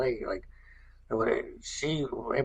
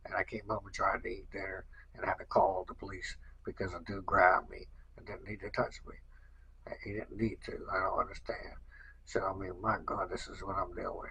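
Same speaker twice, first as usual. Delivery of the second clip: much softer, voiceless, worn-out whisper; real whisper; 8 dB quieter than usual. Real, not acted. Telling viewers about calling the police, in a drained whisper. Whispering her frustration after ordeal.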